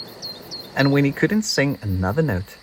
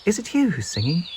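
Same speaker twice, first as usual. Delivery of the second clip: small bird voice; 7 semitones higher, faster than usual. Acted. Peep's questions, voiced high.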